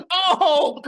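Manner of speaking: real laugh through the word Oh. Real, not acted. Cohost laughs at his chess joke, laughing. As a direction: let the laugh carry it.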